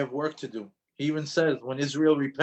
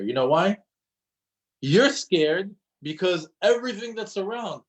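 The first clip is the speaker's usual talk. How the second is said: ordinary talk, nothing marked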